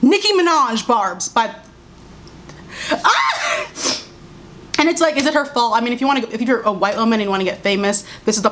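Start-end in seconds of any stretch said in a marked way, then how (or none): 2.9-4.0 short, very high-pitched shriek; high frustrated "ah" shriek; exasperated scream. Real, not acted. Exasperated outburst over the name.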